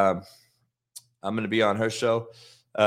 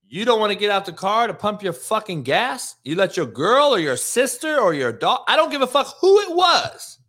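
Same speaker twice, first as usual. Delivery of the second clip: angry retold rant; 9 semitones higher, 4 dB louder than usual.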